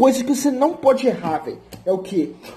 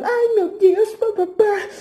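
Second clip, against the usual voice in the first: mock whining; 10 semitones higher, slower than usual. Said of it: "Ninguém quer, quer ver jogador".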